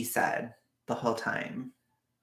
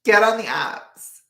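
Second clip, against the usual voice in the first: high, loud, drawn-out order; exasperated shout; 9 semitones higher, 9 dB louder than usual. Real, not acted. Streamer ordering a viewer onto dating apps, loud and slow. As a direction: slowly holler it at them.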